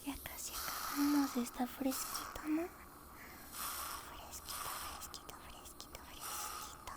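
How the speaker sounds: soft, breathy close-mic whisper throughout; tender roleplay dentist whispering to a patient; ASMR whisper. Acted. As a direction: whisper gently like a dentist soothing a nervous patient.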